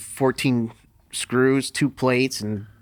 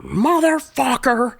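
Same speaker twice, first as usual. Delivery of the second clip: pained yell; 12 semitones higher, 4 dB louder, slower than usual. Retold or imitated. Yelling as his arm breaks.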